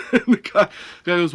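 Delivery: ordinary talk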